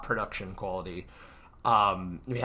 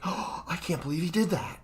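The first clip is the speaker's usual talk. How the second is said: higher, gasping mock disbelief; mock gasped reaction; 7 semitones higher than usual. Showing chat how to react, mock gasped disbelief. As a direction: gasp it like a shocked viewer. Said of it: "if you want to add little".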